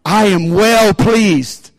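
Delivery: very slow, drawn-out declaration; slow proclamation. Shown as a demonstration. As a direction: stretch every word like a proclamation. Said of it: "say it with me".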